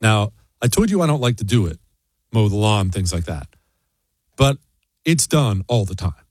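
ordinary talk, nothing marked

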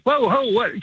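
high, startled whoa; mock surprise. To the cops, a mock surprised whoa what. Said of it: "So I'm like".

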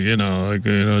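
low, mumbled murmur; lightly demonstrating a mumbler; mumbling. Shown as a demonstration. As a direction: let the words slump into a low mumble. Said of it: "just kinda mumbles".

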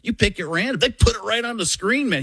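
rising excited coach voice; Jon Gruden impression. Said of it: "He's like".

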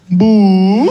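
put-on high, loud ghostly "boo?" in an Indian accent; a ghost's "boo?" in an Indian accent. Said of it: "ghost had an Indian accent".

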